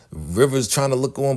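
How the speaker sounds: ordinary delivery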